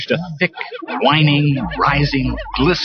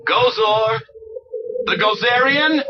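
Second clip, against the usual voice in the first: higher call of the name; 12 semitones higher, slower than usual. A narrator's reading. Stantz to Gozor, calling out. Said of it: "Stantz called".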